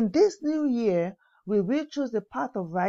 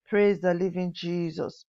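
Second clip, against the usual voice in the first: normal talking voice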